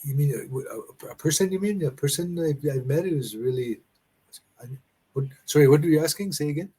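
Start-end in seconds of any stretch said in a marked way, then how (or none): none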